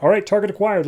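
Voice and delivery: brisk mock report of success; henchman's announcement. Marabunta member tells the others to leave, lightly acted announcement. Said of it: "one of the Marabunta says".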